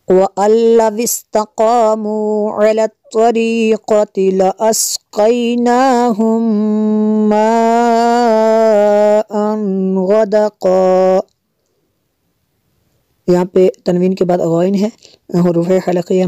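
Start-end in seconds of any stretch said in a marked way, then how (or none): 0.1-11.2 Quran recitation. Shown as a demonstration. Full verse recitation.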